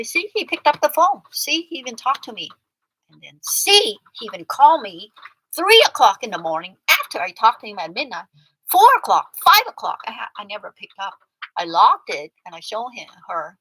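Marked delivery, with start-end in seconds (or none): 0.0-10.0 insistent retelling. Retold or imitated. Retelling what she said to her friend, loud and insistent. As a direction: shove the evidence in her face, loudly. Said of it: "I say".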